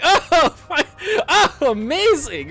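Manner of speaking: fake delight. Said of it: "I'm like".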